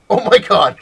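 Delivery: loud, high-pitched shocked exclamation; mock alarm. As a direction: imitate a spooked customer. Startled customer flees the prank.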